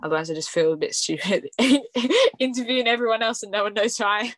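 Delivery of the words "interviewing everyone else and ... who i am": laughing through the words, quicker and higher; sheepish, amused confession in a rush; self-conscious laughing. Real, not acted. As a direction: laugh at yourself as you speak. Embarrassed laugh at herself.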